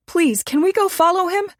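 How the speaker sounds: high, urgent, breathless begging; frantic plea. Acted. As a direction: plead with the cabbie, almost panicking. Lori begs driver after mugging.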